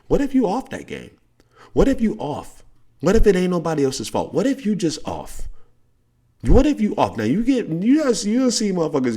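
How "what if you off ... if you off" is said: high, loud coaching push; raised, insistent coach voice; coach challenge. Real, not acted. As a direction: call him out like a coach.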